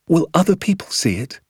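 put-on high, wondering child's question; lightly acted eager child; curious boy. A narrator's reading. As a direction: ask dad in a high young voice. Young boy questions his father.

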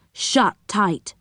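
ordinary delivery, nothing marked

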